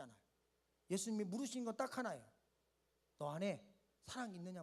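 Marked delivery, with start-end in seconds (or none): 3.2-4.6 much lower and softer, a quiet searching question; almost hush the question; gentle low voice of Jesus; tender. Acted. Preacher voices Jesus softly.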